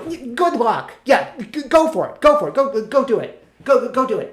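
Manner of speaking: high, loud, stuttering cheering-on; sarcastic encouragement. Acted. Actor to would-be actors, sarcastic egging on. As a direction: push them on with mock enthusiasm.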